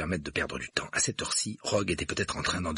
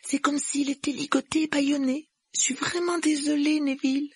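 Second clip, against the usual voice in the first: put-on high, apologetic girl's voice; regretful, pitched way up; sorrowful; 3 dB louder than usual. A narrator's reading. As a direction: explain and apologise, sorry for him. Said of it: "dit Hermione d'une voix navrée".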